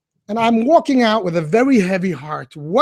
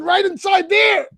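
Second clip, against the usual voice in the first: excited; 11 semitones higher than usual. Retold or imitated. Telling Yankel where he prayed.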